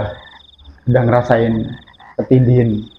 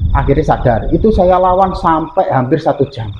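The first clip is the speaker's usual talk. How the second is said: ordinary delivery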